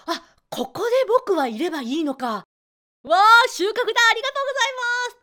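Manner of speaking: 声を高く上げて喜ぶ生徒; 感激してはしゃぐ生徒を演じて; 嬉しそうな生徒. Retold or imitated. 生徒の喜びの声を再現. (高い声ではしゃぐ生徒)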